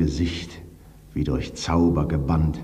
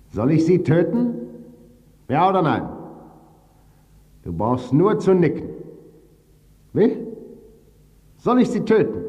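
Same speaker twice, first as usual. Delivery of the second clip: high, louder put-on robber voice; robber's voice; 10 semitones higher, 3 dB louder than usual. Robber presses the bound husband for an answer, high and insistent. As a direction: press the question in a strange high voice. Robber asks whether to kill her.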